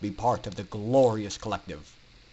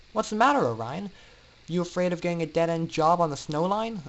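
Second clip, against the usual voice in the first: high, louder, quick playful teasing; teasing Scootaloo; 8 semitones higher, 4 dB louder, faster than usual. Acted. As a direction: poke fun at his nerves, fast and bright. Scootaloo teases nervous friend.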